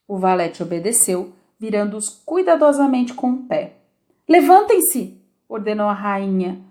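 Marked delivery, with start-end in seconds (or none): 4.3-5.2 high, loud shrill command. A narrator's reading. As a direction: shriek the order at the gardeners. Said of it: "com voz alta e estridente". (ordem alta e estridente da rainha)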